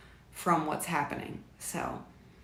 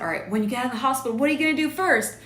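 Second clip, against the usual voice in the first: fast, higher mock reporter question; Super Bowl interviewer; 6 semitones higher, 4 dB louder, much faster than usual. Asking her mom her first plan after hospital, as a fast, higher mock interviewer. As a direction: fire it off like a post-game interviewer. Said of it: "just like in the Super Bowl".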